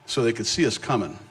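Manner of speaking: ordinary talk, nothing marked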